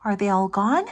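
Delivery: ordinary delivery, nothing marked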